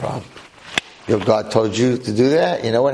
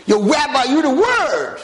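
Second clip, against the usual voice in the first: put-on excited kids; 12 semitones higher than usual. Students praising their rabbi in class, high excited kid voice. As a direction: hype up the rabbi like a cheering teenager. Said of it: "my kids are like".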